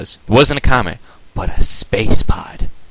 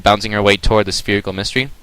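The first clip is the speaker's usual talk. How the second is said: plain reading voice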